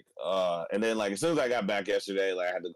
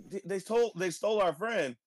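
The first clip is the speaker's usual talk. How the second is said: alarmed cops; 9 semitones higher than usual. Retold or imitated. Cops voiced in retelling.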